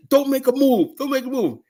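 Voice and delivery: high, commanding yell; warning shout. Retold or imitated. Guard warning the dummy not to move, high commanding yell.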